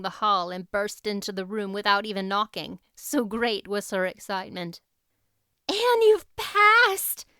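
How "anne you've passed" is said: high, slow and much louder, bursting with joy; girl shouting the happy news; excited cry. Acted. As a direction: cry it out to her friend in delight. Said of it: "she cried".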